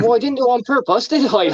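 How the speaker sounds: high, quick mock indignation; retold indignant retort. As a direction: imitate an affronted rider, fast and high. Acted retort of a fallen rider.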